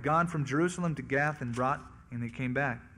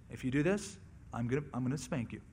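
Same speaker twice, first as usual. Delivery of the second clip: soft, low parent's warning; acted parent, quietly warning a small child; understated, softly spoken threat; mild first warning; 3 semitones lower, 7 dB quieter, faster than usual. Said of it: "until there's a peak level of volume".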